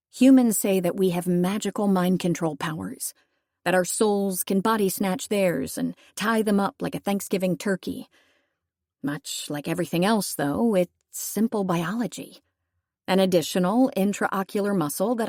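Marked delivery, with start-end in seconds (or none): none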